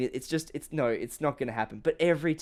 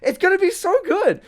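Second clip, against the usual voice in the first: much higher, louder, laughing squeal; giddy laughing excitement; 8 dB louder than usual. Retold or imitated. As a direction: squeal with helpless hype. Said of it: "I'm like".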